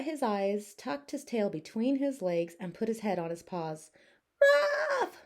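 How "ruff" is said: very high, louder yelp; one high lonely yelp; sad dog bark. Acted. Scared Biff alone, very high yelp. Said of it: "said Biff".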